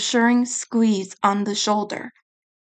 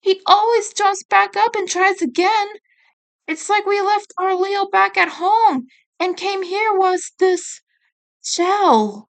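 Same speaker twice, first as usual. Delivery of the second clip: whining; 10 semitones higher than usual. Acted. Mikey whining to Raph about Leo, high whine. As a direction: whine it like a hurt kid.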